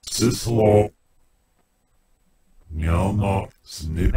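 reversed robotic alien gibberish; garbled backwards alien speech. Shown as a demonstration. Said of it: "Your own alien language and voice".

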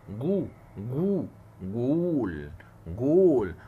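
جول drawn out, ending loud and punched; building slow demo goal. Shown as a demonstration. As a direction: stretch جو then hit جول hard and loud. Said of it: "فقلت جو".

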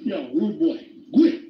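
mock Jamaican snapping quit; Jamaican accent. Imitating a Jamaican telling someone off, Jamaican accent. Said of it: "they be like".

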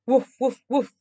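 short imitated woofs; dog barking. A narrator's reading. Storyteller voicing the approaching dog, barking. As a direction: bark it out in separate bursts. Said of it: "at the barking dog".

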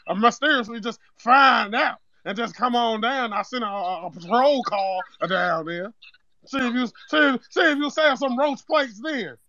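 a lightly put-on accent, prank caller opening the complaint; Zeke from Power. Prank caller tells the home cook about old food, lightly put-on accent. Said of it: "why is he talking like Zeke off power".

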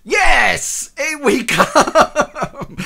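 high, loud hype yell; excited shout. Real, not acted. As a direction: shout it with glee. Loud game launch yell.

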